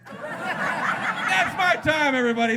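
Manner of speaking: high, loud closing shout; shouted sign-off. Real, not acted. Comic thanking the crowd at the end, shouting. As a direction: belt it out over the applause.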